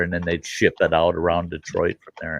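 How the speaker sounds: normal talking voice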